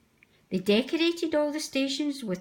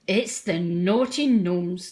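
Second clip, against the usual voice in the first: slow, lower, teasing Scottish engine; 3 semitones lower, 4 dB louder, slower than usual. Acted. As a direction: draw it out to spook Percy. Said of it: "teased Donald".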